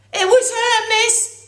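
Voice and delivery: high, loud whiny schoolboy protest. Acted. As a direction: plead your innocence to the teacher. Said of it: "Podgy protested".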